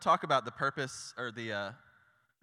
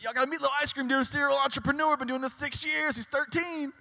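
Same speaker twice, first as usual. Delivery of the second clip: put-on much higher, fast pitch for the young owner; eager street promoter; 12 semitones higher, 4 dB louder than usual. Dad promoting his son's ice cream stand, much higher and fast. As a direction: rattle off the sales pitch like a proud barker. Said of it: "He's like".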